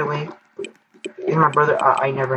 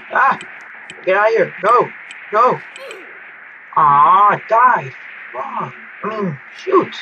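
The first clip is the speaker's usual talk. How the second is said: frustrated yell; 6 semitones higher, slower than usual. Real, not acted. Gamer dying in the disaster, yelling in frustration. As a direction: yelp as you die.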